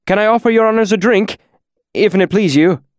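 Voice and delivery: high, fast eager offer; quick, eager. Acted. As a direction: rush the offer, pitched high. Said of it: "the owner offered quickly".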